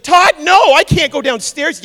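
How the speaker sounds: high loud pleading; scared wife. Retold or imitated. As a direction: refuse in fright. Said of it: "And she's like".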